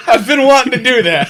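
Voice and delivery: high, loud, laughing through the words. Real, not acted. Guest laughing after the host's loud opener. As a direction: giggle it out gleefully.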